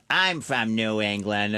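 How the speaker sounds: slow, drawn-out audience member; heckling lady impression. Lady in the crowd answering the comic, slow imitation.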